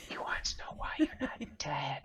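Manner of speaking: hushed whisper, mostly breath. Retold or imitated. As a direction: lean in and whisper it. Repeating the whispered aside.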